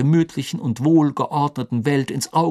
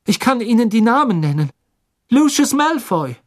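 higher, louder, urgent naming; insistent boy; 10 semitones higher, 6 dB louder than usual. Acted. Harry insists to the minister he saw the Death Eaters, louder. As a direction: force them to listen.

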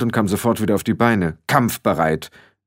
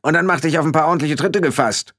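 high, indignant threat; feisty high character voice; Rob Irgendwer calling out; 7 semitones higher, faster than usual. A narrator's reading. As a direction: threaten in a raised-pitch voice. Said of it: "rief Rob irgendwer".

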